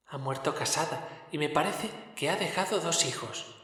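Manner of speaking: más agudo y suave, voz de espíritu lejano; el fantasma habla quedo y solemne; voz del espectro. A narrator's reading. El espectro recuerda a la hermana. (espectro de Navidad, voz aguda y suave)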